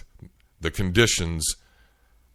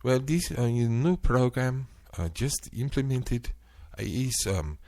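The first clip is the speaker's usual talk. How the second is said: high, faltering mock agent voice; lightly imitated stammering agent; agent's reply; 5 semitones higher than usual. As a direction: play the agent fumbling an excuse, pitched up. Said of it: "And her response was".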